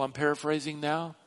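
normal talking voice

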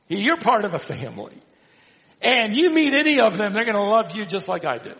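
high, loud, quick pulpit surge; rousing preacher's proclamation; fervent preaching. Retold or imitated. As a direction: burst out with emphatic assurance. Preaches John's assurance.

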